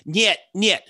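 a mock Russian brushing someone off in a cartoonish Russian accent; bad Russian accent. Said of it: "you have the worst Russian accent in the whole world".

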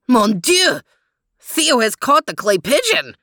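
put-on excited French-accented exclaim; lively French dog voice. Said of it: "exclaimed Max".

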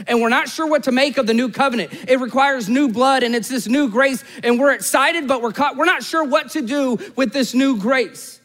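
high-pitched, urgent preacher voice; driving sermon climax, pitch held up; impassioned preaching. Real, not acted. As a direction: pour it out with mounting intensity.

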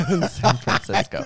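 breathy giggle through the words; laughing. Real, not acted. Answering friend's couple teasing, laughing airily. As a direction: laugh it out, amused.

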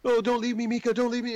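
high-pitched pleading, mock panic; retold panic. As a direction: act out begging not to be left behind. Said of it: "like, panicking".

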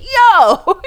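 a giddy call to a friend; laughing yo. Retold or imitated. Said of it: "I'm like".

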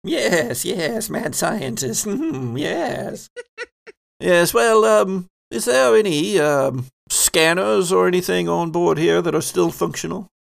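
mad scientist professor. Acted. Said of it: "wait, where's my professor voice?".

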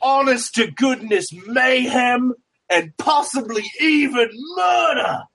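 wrestling promo shout. Acted. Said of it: "look back to my fans like".

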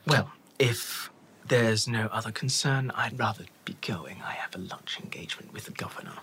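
hushed, low, aloof whisper; whispery elf boss. Acted. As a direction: dismiss them in a soft whisper. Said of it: "The accent is very distinct".

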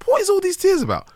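high, bewildered question to himself; mock bewildered squeak; baffled inner voice. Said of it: "Mentally I was like".

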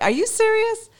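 mock incredulity at the husband; incredulous retort. Wife retelling her reply to her husband, high, disbelieving. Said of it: "I'm like".

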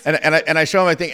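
ordinary delivery

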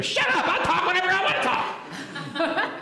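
very high mock outburst; defiant bratty kid. Bad group of kids answering back, defiant. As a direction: mimic a rude pupil in a high-pitched voice.

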